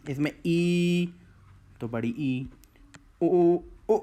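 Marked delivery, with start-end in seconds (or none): 0.1-1.1 ई drawn out long and slow; long vowel demo. Shown as a demonstration. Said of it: "ई बोल रहा हूँ तो इसमें थोड़ा कम समय". 3.2-4.0 उ said short and clipped, three times; short vowel demo. Shown as a demonstration. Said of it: "छोटा उ".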